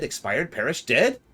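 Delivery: high squeaking shriek of shock; a goblin's hammed-up wail; shocked squeal. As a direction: shriek the word in horror. Voicing Gigu's shocked cry.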